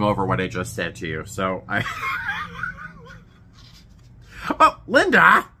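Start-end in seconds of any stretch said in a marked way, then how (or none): 1.9-2.9 shrieking burst of laughter; high laughing shriek. Real, not acted. 4.6-5.5 loud, high delighted call; delighted cry. Real, not acted. Delighted exclamation at card.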